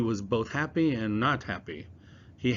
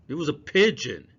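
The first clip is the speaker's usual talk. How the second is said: unfriendly; 6 semitones higher, 7 dB louder than usual. Acted. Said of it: "His voice was not friendly".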